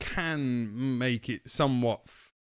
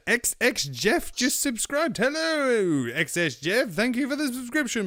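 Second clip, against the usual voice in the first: excited greeting; 10 semitones higher than usual. Real, not acted. Greeting a new subscriber.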